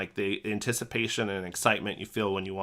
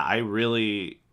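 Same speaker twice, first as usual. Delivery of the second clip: ordinary talk, nothing marked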